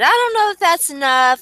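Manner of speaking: high-pitched, self-mocking idiot quote. Fantasy player mocking his own bad trade offer, mock idiot. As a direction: play your own foolish words back way up high. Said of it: "like an idiot".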